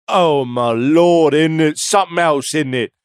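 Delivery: amazed English onlooker, hammed up; mock English accent.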